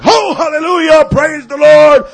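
high, loud revival shouting; hammed-up hallelujah preacher in full cry; mock faith healer. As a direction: parody a showy healing prayer. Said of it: "Didn't make a like".

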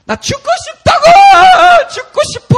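very high, piercing despairing shriek; full-force imitated demonized wail; possessed girl screaming. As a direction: scream it out like someone tormented. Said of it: "she just starts going".

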